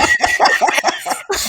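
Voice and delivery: high burst of real laughter; helpless giggling fit; laughing. Real, not acted. Laughing with dad over the churros story, real laughter.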